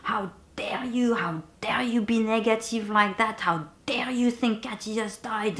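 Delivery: fierce whispered scolding at herself; whispered self-reproach, shaking with anger; angry self-scolding. Retold or imitated. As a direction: hiss it at yourself, furious but hushed. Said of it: "I was so angry at myself".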